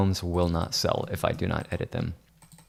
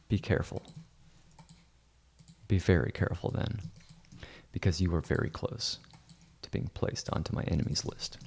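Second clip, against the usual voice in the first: soft, measured warning, sinking lower; quiet mock threat; 3 semitones lower, 7 dB quieter, faster than usual. Editor warns grovelling realtors about enemies list, quiet menace. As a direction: warn softly, letting the voice drop away.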